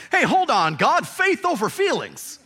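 high, loud, laughing mock objection; hammed-up Moses interrupting God. Moses mockingly telling God faith over feelings. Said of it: "what if Moses had said".

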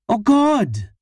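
high, loud sudden cry; shocked outburst. Acted. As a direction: blurt it out in disgust. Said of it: "Her sudden outburst".

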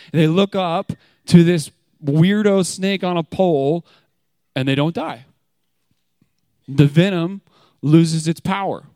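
loud, high, driving sermon voice; preaching at full force. Acted. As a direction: build it up like a revival preacher.

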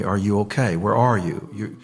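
ordinary talk, nothing marked

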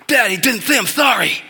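put-on tattling little girl. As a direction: call it out like a kid tattling. Daughter reports brother didn't apologize.

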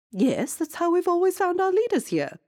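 very high, loud queen voice; queen defending tradition; proud queen. Acted. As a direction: state it proudly.

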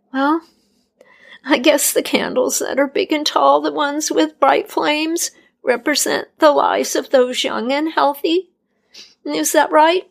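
a lightly voiced timid young woman guessing; unsure young healer. A narrator's reading. Healer guessing what the candles mean, unsure.

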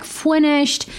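high, drawn-out mock voice; mock partner. Her partner's safe word read out, high and long. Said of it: "He wrote back".

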